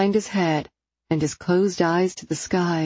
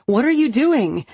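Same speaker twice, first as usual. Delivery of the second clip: yelling; 6 semitones higher, 4 dB louder than usual. A narrator's reading. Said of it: "kicking and yelling".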